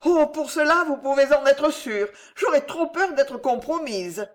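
high, artless assurance; naive. A narrator's reading. Devout woman assures Pardaillan of silence, naively. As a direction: gush a naive promise, pitch well up. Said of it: "fit naïvement la dévote".

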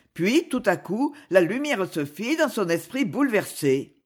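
ordinary delivery, nothing marked

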